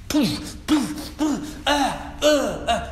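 soft high anguished yelps of the stricken; mock cries. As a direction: gasp like people hit by serpents. Acting out people struck down.

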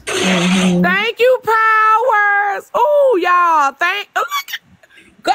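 overwhelmed thanks, leaping high; real, gushing gratitude; emotional. Real, not acted. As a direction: thank them as if it is too much to take. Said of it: "Now y'all making me emotional".

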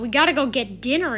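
ordinary talk, nothing marked

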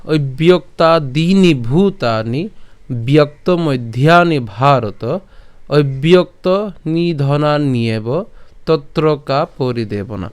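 slow, syllable by syllable Sanskrit recitation; careful teacher's recitation. Shown as a demonstration. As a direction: read the shloka slowly and clearly. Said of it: "আমি যদি শ্লোকটা যদি আবার প্রথম থেকে বলি".

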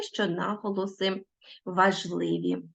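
normal talking voice

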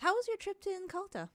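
high, quick feminine voice; Arathis. Acted. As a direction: lift the pitch for a brisk in-character question. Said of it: "And she asks".

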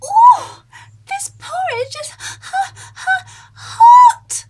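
very high, loud little cub voice; Baby Bear. Acted. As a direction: squeak it out like a tiny bear burning its tongue. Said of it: "Baby Bear said".

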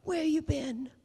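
imitating Lloyd. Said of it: "And he said".

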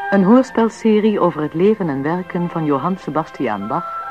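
ordinary talk, nothing marked

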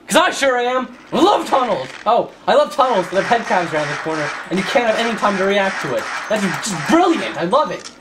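loud, hammed-up mock delight; sarcastic enthusiasm. Gamer pretending to love the tunnels, sarcastic enthusiasm. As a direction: gush about it with heavy sarcasm.

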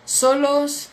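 ordinary talk, nothing marked

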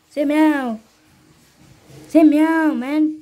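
urging the cat to meow, loudly; loud coaxing. Real, not acted. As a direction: egg the kitten on, loud and playful.

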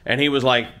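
ordinary talk

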